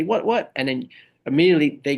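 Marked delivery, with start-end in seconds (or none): 0.0-0.5 mock confrontational snap. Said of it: "they come and say".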